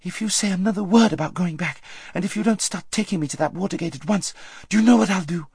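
high, urgent scolding; merciless threat. A narrator's reading. Aravis shaking her friend into obeying, fiercely. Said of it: "no occasion for mercy".

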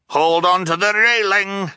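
high, loud rapid-fire orders, cheerful and scattered; cheerful scattered shouting. Acted. Captain shouting jumbled ladder instructions at the orphans, cheerful. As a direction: bark silly commands cheerfully, changing mind constantly. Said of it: "the voice continued".

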